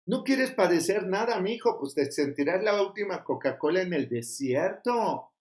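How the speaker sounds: usual speaking voice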